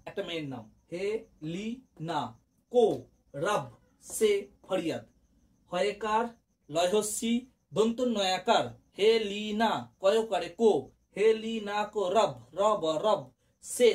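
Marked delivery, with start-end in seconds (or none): 0.9-10.5 syllables pulled apart, one at a time; very slow dictation. Shown as a demonstration. Teacher dictating the mnemonic slowly to students. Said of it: "আমি আস্তে আস্তে বলছি".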